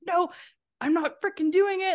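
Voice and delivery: high, loud refusing whine; retold stubborn refusal to roommates; whiny protest. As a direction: dig in and refuse.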